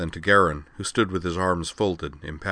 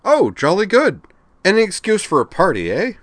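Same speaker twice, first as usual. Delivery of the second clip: loud, high, silly-jolly braying; cheery bray; 13 semitones higher, 8 dB louder than usual. A narrator's reading. Garen greets sister's marriage news, braying cheerily. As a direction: bray it like a cheerful upper-class twit. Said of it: "he brayed cheerily".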